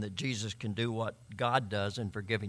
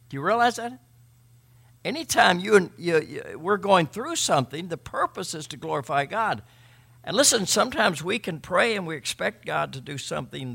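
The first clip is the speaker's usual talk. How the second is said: loud, higher, slowed on glorifying God; fervent preaching; 6 semitones higher, 6 dB louder than usual. Acted. Pastor exults over the healed man to his congregation, full-force preaching. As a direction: preach it like a celebration.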